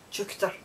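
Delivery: "chuchter" with a throaty ch in the middle; Scots pronunciation. Shown as a demonstration. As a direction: say "chuchter", ch-OOCH-ter with a rasped middle. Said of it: "it's pronounced".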